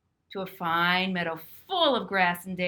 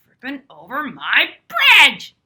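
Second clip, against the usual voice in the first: booming troll roar, much louder and slow; 13 dB louder, slower than usual. Acted. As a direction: roar it out like an angry troll under the bridge. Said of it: "roared the troll".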